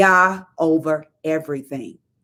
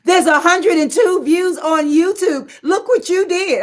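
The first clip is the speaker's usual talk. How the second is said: excited daughter; 11 semitones higher, slower than usual. Retold or imitated. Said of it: "She was like".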